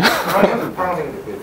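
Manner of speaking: short, quiet teasing laugh; soft laugh. Real, not acted. Teacher teasing students about the pink uniform, soft laugh. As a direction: laugh softly at your own teasing.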